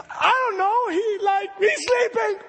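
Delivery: high, crying shout; panicked cry. Retold or imitated. Disciple crying out that Jesus is asleep. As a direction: wail it in disbelief.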